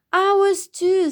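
high, eager chime-in; excited agreement. A narrator's reading. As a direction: chime in with delight. Friends chime in with delight.

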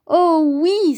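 high, loud little-bear cheer; joyful cry. A narrator's reading. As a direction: burst out with relief. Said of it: "s'écria Petit Ours". (s'écrier de joie)

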